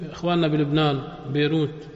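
ordinary talk, nothing marked

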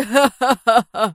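coarse belly laugh; loud coarse laugh. A narrator's reading. Adib ending the phone chat, coarse laugh. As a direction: laugh roughly and heartily. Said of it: "أطلق ضحكة عالية فظة".

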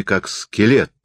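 ordinary delivery

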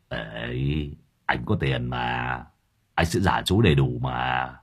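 low, soft wheedling voice; low-voiced Lâm Thọt. A narrator's reading. Lâm Thọt promising to pay back, low wheedle. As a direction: coax him quietly.

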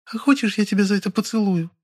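high, coaxing female purr; high-pitched kittenish seduction. A narrator's reading. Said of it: "добавила мурлыкающим голосом".

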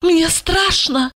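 high, slowed, fearful woman's voice; tipsy woman scared, pitched high; frightened confiding. A narrator's reading. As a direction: confide your fear like a secret. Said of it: "прошептала".

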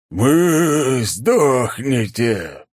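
slow roaring monster threat; deep distorted monster roar. A narrator's reading. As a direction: roar it out like a beast. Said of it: "раздался рёв твари". (рёв чудовища)

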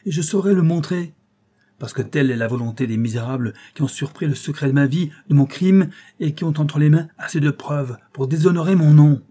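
crié, beaucoup plus fort et plus aigu; il crache sa honte et sa rage; colère éclatante. A narrator's reading. Comte avoue son crime, furieux. (éclat de colère, crié plus fort et plus aigu)

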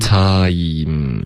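"Time" drawn out from ah through ee to m; slow glide. Shown as a demonstration. Teacher modelling for the host to repeat, drawn out. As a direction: stretch "Time" through each sound. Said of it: "要慢慢地练".

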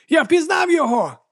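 very high, excited cry; exclaiming. A narrator's reading. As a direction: call out in sudden recognition. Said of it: "вигукнув один із сутенерів".

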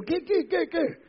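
high, quick repeated questions; nosy bystander begging for news; eager gossip. Acted. Preacher voicing a nosy onlooker in the crowd, high and eager.

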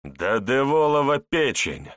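loud, high furious curse; furious roar. Acted. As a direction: roar it out in rage. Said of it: "разъярённый рёв".

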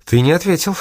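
high-pitched, insistent reminder; stubborn insistence. A narrator's reading. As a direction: press the point stubbornly. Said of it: "упрямо напомнила она".

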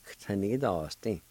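"K, ta, ni, das, din" as one connected word, opening on a very quick K; quick K sound. Shown as a demonstration. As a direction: clip the K straight onto "ta" and carry on without pauses. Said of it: "had to say that K sound real fast".

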